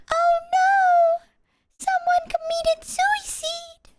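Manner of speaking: very high, slow dubbed-woman voice; dub lady voice. Retold or imitated. Imitating the dub lady announcing a death. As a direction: mimic a shrieking dub actress. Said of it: "that lady from the dub".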